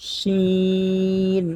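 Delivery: শিন drawn out long; letter demo. Shown as a demonstration. Teacher demonstrating শিন, drawn out. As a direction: stretch শিন slowly. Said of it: "শিন হচ্ছে".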